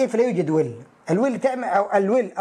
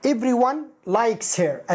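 slow, careful model sentence; 2 semitones higher, much slower than usual. Shown as a demonstration. Teacher slowly gives the original active sentence. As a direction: say it slowly for the class. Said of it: "أصلها".

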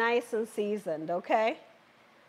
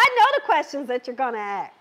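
rattled off fast and light; quick aside; 3 semitones lower, faster than usual. Real, not acted. Host to her live viewers, tossed off quickly. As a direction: toss it off quickly, half to herself. Says it twice, then laughs.

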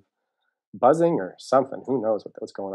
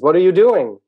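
loud, high shouted question; calling out; 8 semitones higher, 12 dB louder than usual. Acted. Turtle asking the distant mole, calling out.

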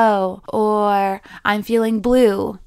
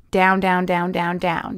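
each down lower and softer; sinking downward; 4 semitones lower, 4 dB quieter than usual. Shown as a demonstration. Teacher sinking her voice as she describes the valley. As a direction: let the voice sink into the valley. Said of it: "Your valley".

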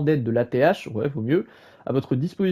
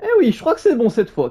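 ordinary talk

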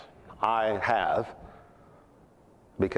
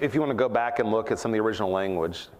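ordinary talk

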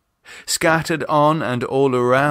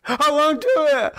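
panicked. Acted. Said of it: "he cried".

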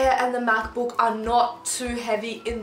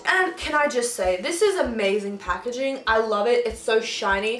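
ordinary talk, nothing marked

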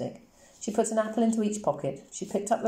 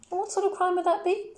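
indulgent officer, higher; humouring policeman; 13 semitones higher than usual. A narrator's reading. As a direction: play along with the child's story.

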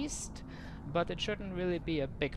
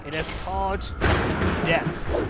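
dramatic announcement; 9 dB louder than usual. Real, not acted. Announcing killing spell dramatically.

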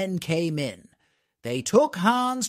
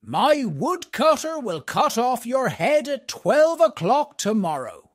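higher, louder, stern threat from the king; an angry king sentencing a man, higher and louder; angrily; 7 semitones higher, 3 dB louder than usual. A narrator's reading. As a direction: threaten him with the block, voice raised. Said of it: "he said angrily to Hans".

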